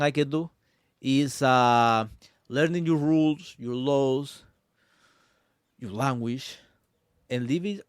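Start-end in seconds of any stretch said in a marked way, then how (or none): none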